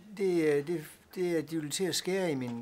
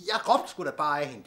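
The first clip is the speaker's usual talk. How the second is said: loud panicked excuse; 10 dB louder than usual. Acted. Man panics over dead woman.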